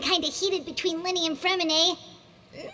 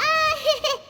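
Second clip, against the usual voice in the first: awkward laugh; 8 semitones higher, 5 dB louder than usual. Acted. Awkward entrance interrupting the pair.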